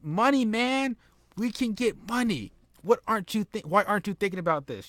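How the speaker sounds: high, disbelieving dude voice; can't believe he turned it down; incredulous wrestler. Retold or imitated. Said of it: "Chuck and Sean is like".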